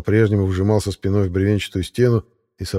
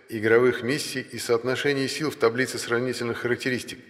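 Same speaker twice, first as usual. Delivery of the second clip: softer, even announcement voice; system message read; 4 semitones higher, 4 dB quieter than usual. A narrator's reading. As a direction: read it like a game notification. Reads the game system message.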